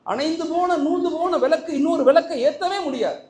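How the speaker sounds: forceful preaching. Acted. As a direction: preach it out to the hall with fire.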